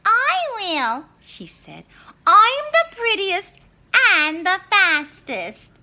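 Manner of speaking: put-on high, loud, slow bragging girl; Little Red. Little Red boasting to the wolf, high and loud. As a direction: crow about being the best.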